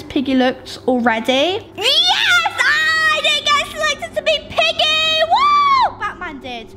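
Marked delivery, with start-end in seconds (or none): 1.8-6.0 excited cheer. Real, not acted. Player not chosen as Piggy, real high excited cheer. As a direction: cheer like you just dodged it.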